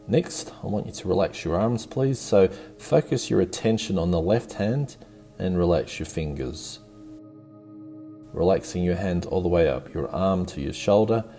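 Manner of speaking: usual speaking voice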